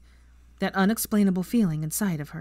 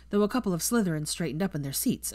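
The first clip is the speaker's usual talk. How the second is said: usual speaking voice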